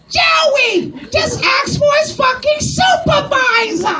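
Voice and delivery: very high, drawn-out screech; shrieking high-pitched Italian wife; screaming Italian wife. Acted. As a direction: scream from the background like a nagging wife. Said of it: "Italian wife in the background screaming".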